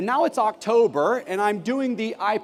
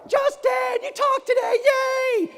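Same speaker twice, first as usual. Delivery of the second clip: gushing parents; slower than usual. Retold or imitated. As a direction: cheer him home like thrilled parents. Parents greeting him at door.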